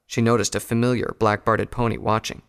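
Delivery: ordinary delivery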